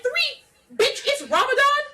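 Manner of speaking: high, loud, laughing rant; worked-up tirade, laughing. Retold or imitated. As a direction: rant it out for Isaac, cracking up. Ranting as Isaac, laughing.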